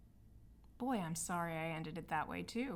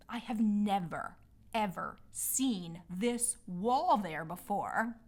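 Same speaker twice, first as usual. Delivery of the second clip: slow, louder, amazed disbelief; astonished; 5 dB louder than usual. Real, not acted. Telling listeners about a wall in the woods, louder and astonished. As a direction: marvel at it, drawing each phrase out.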